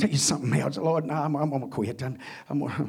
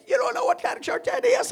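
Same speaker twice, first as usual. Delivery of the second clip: pitched way up in disbelief; high incredulous voice; 6 dB louder than usual. Retold or imitated. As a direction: squeak it out in mock disbelief. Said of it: "He said".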